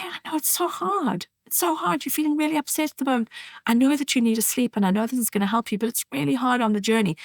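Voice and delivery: put-on high, soft, gentle comforting of a crying baby; tender soothing to baby. Shown as a demonstration. Parent soothing a crying baby, soft and high. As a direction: coo comfort softly, like holding an upset infant. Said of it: "Just say".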